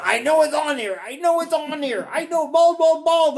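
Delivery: high, loud eager taunting; excited teasing. Retold or imitated. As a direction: sister gleefully hunting the clip, then bald bald. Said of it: "she's over here going".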